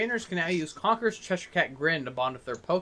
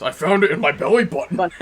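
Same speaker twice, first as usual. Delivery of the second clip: loud, growly beast voice; 6 dB louder than usual. Retold or imitated. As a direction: roar it like the Beast. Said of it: "in the Beast voice".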